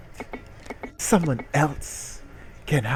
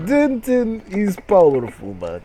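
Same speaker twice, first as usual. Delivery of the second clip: put-on high, loud accented alien block voice; mock Russian-accented block character boasting; 6 semitones higher, 6 dB louder than usual. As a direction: play the block as a proud foreign comrade, pitched up. Block explains Denton's basement.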